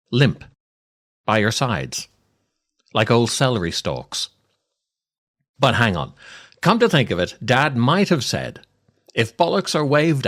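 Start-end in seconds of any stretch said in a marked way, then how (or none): none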